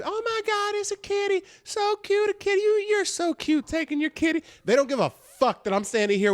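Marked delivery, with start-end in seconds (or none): 0.0-4.4 much higher mock baby-talk coo; cooing strangers. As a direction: gush over the kitty in a squeaky imitation. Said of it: "everyone just keeps".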